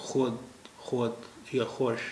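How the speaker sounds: खुद and खुश said with a w-glide, "khwad", "khwash"; old pronunciation. Shown as a demonstration. Teacher reconstructing how old Persian words were once said, archaic w-glide pronunciation. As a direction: slowly sound the silent wāw in खुद and खुश. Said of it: "जैसा कुछ उच्चारण रहा होगा".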